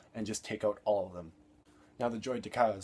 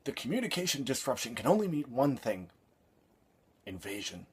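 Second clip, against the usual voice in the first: mock wooden movie delivery, letting the final word fall flat; deadpan parody of a stiff actor; imitating the actor; 3 semitones higher, 4 dB louder than usual. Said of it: "deliver it exactly the way that the actor delivered it".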